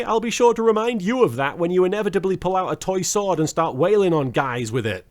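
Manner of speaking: ordinary delivery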